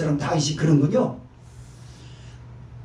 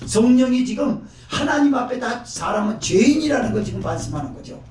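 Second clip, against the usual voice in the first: normal talking voice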